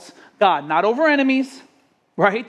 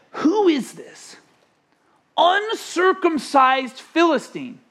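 higher, slow, scornful outrage; David's indignant tone; 8 semitones higher, slower than usual. Retold or imitated. Preacher voicing David's outrage at Goliath, slow and scornful. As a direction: spit out the insult like an offended young warrior. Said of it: "Don't you love the tone of David's voice?".

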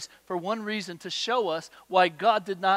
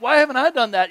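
louder, higher jealous outburst; envious whine, much louder; jealous brother; 6 semitones higher, 12 dB louder, faster than usual. Retold or imitated. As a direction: blurt it like a resentful older brother. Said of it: "he's frustrated".

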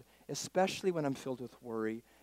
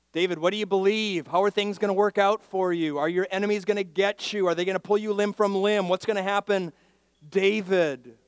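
raised, high volley of questions; pressing questioning; 7 semitones higher, 10 dB louder than usual. Acted. Preacher challenges David about his enemies, pressing questions. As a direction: fire questions insistently, voice up.

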